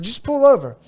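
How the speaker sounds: high, loud, urgent demand; urgent insisting. Retold or imitated. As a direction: insist he pull over right now. Friend insisting, pitched up.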